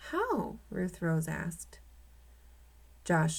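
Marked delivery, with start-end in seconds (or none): 0.1-0.6 put-on sharp high girl's question; girl asking. A narrator's reading. Girl voiced in read story.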